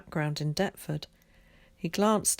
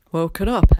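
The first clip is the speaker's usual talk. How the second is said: loud; 11 dB louder than usual. A narrator's reading. Tom calls back from cart.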